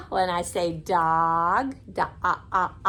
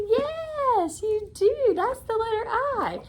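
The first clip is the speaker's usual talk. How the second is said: excited praise; 11 semitones higher than usual. Real, not acted. Praising a child's answer.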